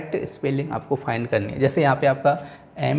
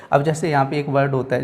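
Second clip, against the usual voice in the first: ordinary delivery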